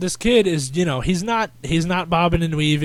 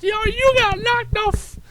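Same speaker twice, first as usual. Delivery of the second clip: very high falsetto heckle; high-pitched mock gloating; imitated taunting yell. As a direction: crow it like a hysterical onlooker. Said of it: "like that, like".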